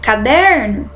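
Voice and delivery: "Caderno" with DER stretched like a call; mock call, long DER in "Caderno"; calling out the word. Shown as a demonstration. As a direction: call "Caderno", swelling on the middle syllable. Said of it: "como se você realmente estivesse gritando".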